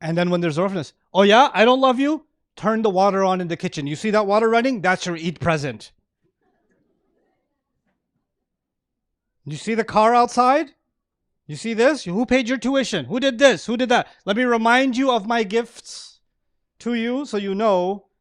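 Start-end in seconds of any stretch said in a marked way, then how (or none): 1.1-2.2 loud, high mock retort from a resentful kid; bitter child's outburst. Child snapping at parent, loud and high. 2.5-18.1 raised, higher-pitched parent rubbing in the gifts; guilt-tripping parent. Retold or imitated. Parent reminding kid of gifts, raised and higher.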